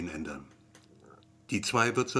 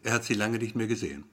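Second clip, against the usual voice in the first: usual speaking voice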